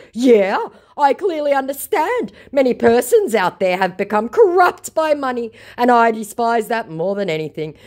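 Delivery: high, earnest reading of his smarmy message; lightly voiced scammer. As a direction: read his flattery with fake sincerity.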